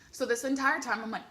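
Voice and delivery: ordinary talk, nothing marked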